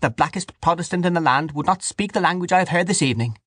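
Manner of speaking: high, righteous woman's voice; high, offended accusation; indignant Dante character. Acted. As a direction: condemn him in a high pitch.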